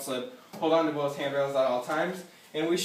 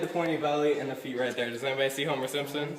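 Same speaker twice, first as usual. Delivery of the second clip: ordinary delivery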